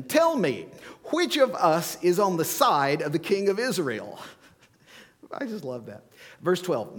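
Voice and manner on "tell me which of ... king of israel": the angry king interrogating his officers, loud and high; enraged king demanding answers from his officers, loud and high. Acted. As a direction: raise it to a furious royal demand.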